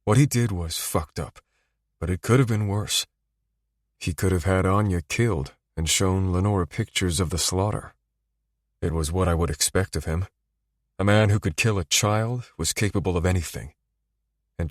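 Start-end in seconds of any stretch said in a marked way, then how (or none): none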